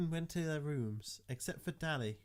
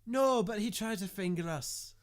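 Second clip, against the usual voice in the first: high protest; 9 semitones higher, 7 dB louder than usual. Acted. Protesting to teacher.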